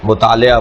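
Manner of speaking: مطالعہ said mu-taa-le-aa, everyday "le" form; common mispronunciation. Shown as a demonstration. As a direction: say مطالعہ with "le" in place of "la". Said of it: "اس کو غلط بولتے ہیں ہم".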